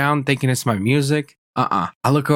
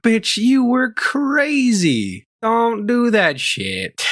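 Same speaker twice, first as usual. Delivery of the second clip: high, drawn-out exasperation; pitched-up, slow mock telling-off; exasperated scolding; 10 semitones higher, much slower than usual. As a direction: scold an absent troublemaker, stretching the words. Scolding the absent woman, exasperated.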